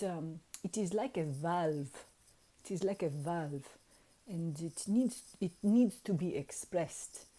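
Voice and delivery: quiet, halting impression of the accented adviser; imitated wizard. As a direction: suggest it tentatively in an imitated accent. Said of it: "he was like".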